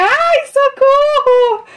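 very high, loud, drawn-out squeal; overjoyed cry, held high and long; excited squeal. Real, not acted. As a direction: squeal it out, stretching every vowel. Squeals on reading the gift note.

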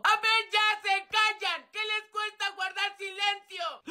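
high, slow, exasperated teacher; exasperated scolding. Acted. Teacher losing patience with class, exasperated. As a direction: plead for silence, high and stretched out.

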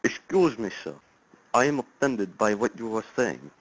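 lightly put-on Russian accent, stiff and formal; a hint of a fake Russian caller; weak mock Russian accent; bad Russian accent. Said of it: "That is the worst accent I think I've ever heard".

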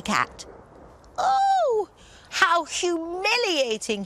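very high, loud scaredy-cat wail; meowing cat. Acted. Lion turned scaredy cat wails, very high. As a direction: whine like a frightened kitten. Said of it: "he meowed".

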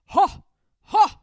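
huge booming troll laugh "Ha! Ha!"; loud gloating troll shout; bellowing trolls. Acted. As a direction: bellow it like two big trolls. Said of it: "they bellowed together".

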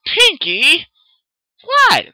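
very high, loud yell; shouting. Acted. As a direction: call her out sharply. Friends shout at each other.

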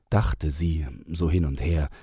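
ordinary delivery

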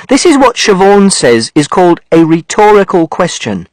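plain reading voice

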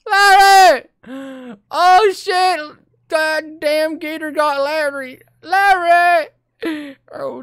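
high, loud panicked hillbilly villager; a frantic villager discovering his friend dead, shrieking. Retold or imitated. Player voicing a villager finding Larry dead, high panicked yelling. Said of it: "and he's like".

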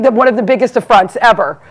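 ordinary delivery

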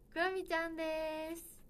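drawn-out fanfare intro; grand announcement. Acted. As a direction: announce her like a star arriving. Grand entrance announcing guest.

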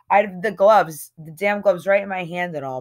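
ordinary delivery

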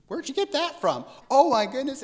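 high, incredulous squeal of a question; mock astonishment. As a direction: act baffled at the new twang. Astonished at relative's new accent.

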